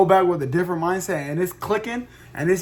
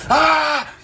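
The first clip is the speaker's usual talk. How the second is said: mock caffeine scream, much higher and louder; retold scream; 11 semitones higher, 7 dB louder than usual. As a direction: let out a hammed-up hyper yell. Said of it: "I was in the back of the car screaming".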